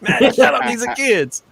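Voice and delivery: high, loud retold outburst; alarmed scolding. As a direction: yelp it at a friend, half laughing. Retelling his alarmed scolding of Matt.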